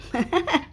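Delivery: short real laugh rising at the end; amused laugh. Real, not acted. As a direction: laugh at your own joke. Laughs at her own joke.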